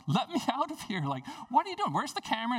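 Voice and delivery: high, urgent retold panic; half-acted trapped pastor calling out; mock frantic call from a locked room; panicked plea. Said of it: "kicking the door, like".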